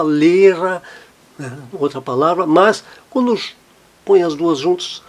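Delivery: ordinary talk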